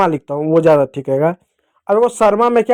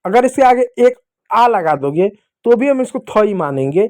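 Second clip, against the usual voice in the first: ordinary talk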